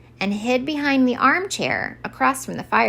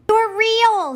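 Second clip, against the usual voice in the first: much higher, louder joyful cry; joyful shout; 14 semitones higher, 4 dB louder than usual. Acted. Santa discovering Harold is real, joyful shout. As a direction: burst out in delighted disbelief. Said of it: "he shouted joyfully".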